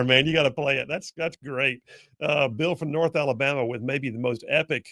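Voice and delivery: ordinary delivery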